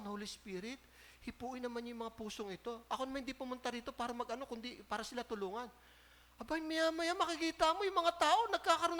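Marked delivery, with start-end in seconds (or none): 0.0-5.7 silent prayer. Retold or imitated. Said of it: "nagpipray ako sa aking isipan".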